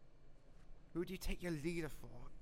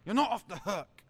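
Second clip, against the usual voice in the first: sudden loud furious yell; angry shout; 5 semitones higher, 10 dB louder than usual. Acted. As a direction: bark it at them. Leader scolding generals.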